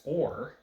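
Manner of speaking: "or" punched high and loud; "or" singled out loudly; stress on OR. Shown as a demonstration. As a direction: hit the word "or" as the key alternative. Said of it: "if it was".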